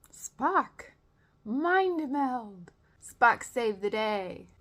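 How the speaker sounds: loud, high, stretched-out mock plea; mock command. Voicing the crew's fallback plan, mock-dramatic call. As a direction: call on the hero with hammed-up urgency.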